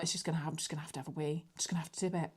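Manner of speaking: hushed and fast, muttering to herself; fast hushed inner voice before a roadside wee; hushed self-talk. Retold or imitated. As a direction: rush it under your breath like a desperate secret. Retelling her own urgent thoughts.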